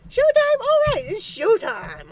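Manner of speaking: big hyped call to start the show; fired-up showtime shout; excited shout. Real, not acted. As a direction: yell it out, thrilled.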